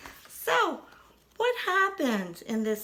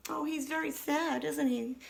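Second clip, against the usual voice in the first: ordinary delivery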